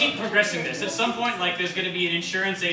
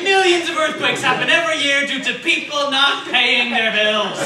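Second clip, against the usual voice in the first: high, loud, laughing fake explanation; mock lecture; 8 semitones higher, 9 dB louder than usual. [name] translating the diagram of Japan, loud laughing nonsense. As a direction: deliver the nonsense fact, cracking up.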